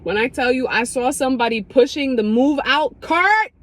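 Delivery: ordinary talk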